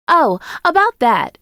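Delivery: high, drawn-out, sheepish best-friend voice; slow, high apologetic character voice; apologetic Jada. A narrator's reading. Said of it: "looks at me apologetically".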